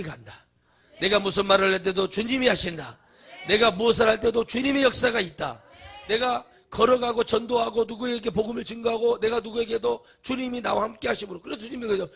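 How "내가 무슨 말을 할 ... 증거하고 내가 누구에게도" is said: full-force preaching. Acted. Preacher proclaims with rising force.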